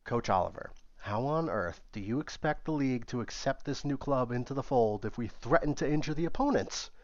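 usual speaking voice

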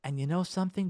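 ordinary talk, nothing marked

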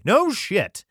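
loud, high mock surprise; sarcastic no shit. Real, not acted. Reader mocks the obvious verse, loud sarcastic jab. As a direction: bark it out with heavy sarcasm.

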